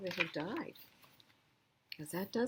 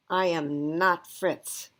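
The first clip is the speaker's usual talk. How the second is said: slow and loud, NOT stressed; indignant protest; 7 dB louder, much slower than usual. Acted. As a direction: insist slowly, offended at the name. Dog protests wrong name.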